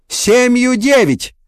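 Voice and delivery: high, drawn-out sudden bark; barked shout. A narrator's reading. Woman boss testing the applicant, barking at full pitch. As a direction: fire it out high and slow. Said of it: "гаркнула, что есть мочи".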